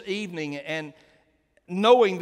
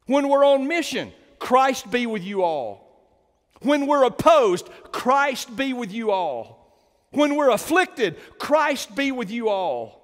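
preaching at full force; 6 semitones higher, 6 dB louder than usual. Real, not acted. Building blessing litany.

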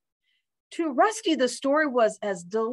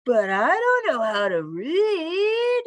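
higher, slow, swooping dude voice; dude dog; 8 semitones higher, slower than usual. A narrator's reading. Dog admits to the bird he can't read, slow dude voice. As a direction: stretch it out like a slacker dog.

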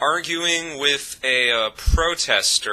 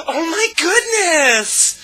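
hammed-up high wail, long and drawn out; mock exasperation in a slow, loud, very high squeal; Phelan Palin; 5 dB louder, much slower than usual. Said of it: "where she does the whole".

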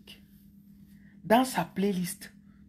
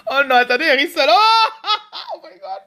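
high, loud words through real laughter; squealing with laughter; helpless laughing; 7 semitones higher, 9 dB louder than usual. Real, not acted. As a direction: struggle to talk while cracking up. Laughing fit at own impression.

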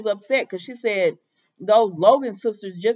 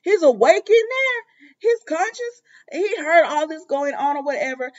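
excited Mike; 7 semitones higher, 4 dB louder than usual. Retold or imitated. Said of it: "And so Mike said".

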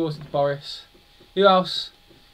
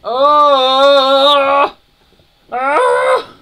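shrieking much higher and louder in outrage; outraged screaming; 13 semitones higher, 15 dB louder, much slower than usual. Real, not acted. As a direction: scream at being caught. Caught by the enemy again.

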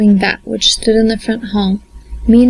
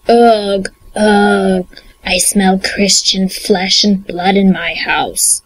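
slow growling troll, sniffing; a big troll sniffing the air; 2 semitones lower, slower than usual. Acted. Troll voice in fairy tale.

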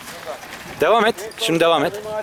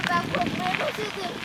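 scared; 12 semitones higher, 10 dB quieter than usual. Pretend. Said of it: "Korkmaya mı başladın?".

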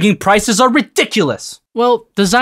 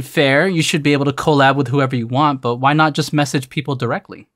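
ordinary talk

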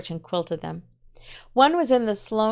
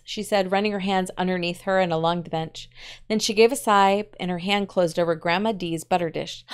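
plain reading voice

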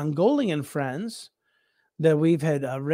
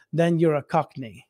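ordinary talk

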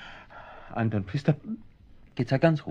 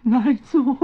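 flustered; 12 semitones higher, 6 dB louder than usual. Acted. Man hastily fixes his face.

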